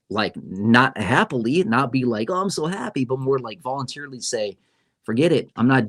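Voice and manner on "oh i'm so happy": ordinary delivery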